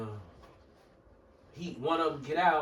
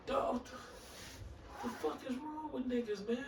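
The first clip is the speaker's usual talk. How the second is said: hushed, higher-pitched disbelief; stunned aside; 5 semitones higher, 12 dB quieter than usual. Retold or imitated. Bystander reacting to the threat at the counter, hushed disbelief. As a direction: say it under your breath, baffled.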